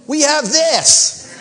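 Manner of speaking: loud, high, drawn-out cry; big high-pitched burst of insistence; exclaiming. Real, not acted. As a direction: shout it out slowly to the congregation. Exclaimed correction of his own envy.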